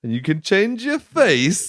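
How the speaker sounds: high, slow imitation; mock dramatic quote. Kuryakin calling to the departing prisoner, hammed-up. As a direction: draw it out as a hammed-up parting line. Quoting Kuryakin's odd parting line.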